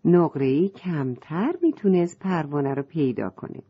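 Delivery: usual speaking voice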